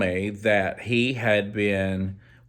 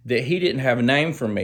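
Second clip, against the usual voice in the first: ordinary delivery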